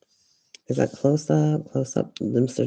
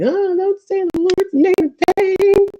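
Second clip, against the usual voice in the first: very high, loud, squealed mockery; mocking scold; 8 dB louder than usual. Mocking someone who objects to cussing, exaggerated scolding. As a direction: shriek it as a ridiculing imitation. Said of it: "someone's saying".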